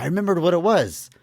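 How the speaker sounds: high-pitched, urgent announcement, retold; urgent recollection. Zeke wakes Phil to say he remembered, retold urgently. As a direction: voice jumps up, imitating someone who just remembered. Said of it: "it's obviously very urgent".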